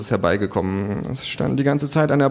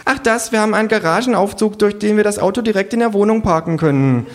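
trendy hostess; 11 semitones higher, 4 dB louder than usual. Acted. Hostess answers.

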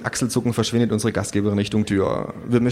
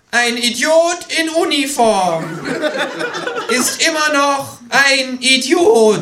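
langsam, hoch und laut gedehnt; in die Security hineinbrüllen; schreit; 4 dB louder, slower than usual. Acted. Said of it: "Das Känguru schreit". (langsames Gebrüll des Kängurus)

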